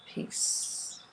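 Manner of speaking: soft whispered "Peace" on the out-breath. Shown as a demonstration. Coach ending the tapping round, whispering Peace. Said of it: "deep breath in, blow it out".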